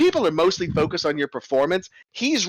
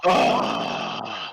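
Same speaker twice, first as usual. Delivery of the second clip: guard's grunt; 12 semitones lower than usual. Acted. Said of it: "you hear the guard go audibly".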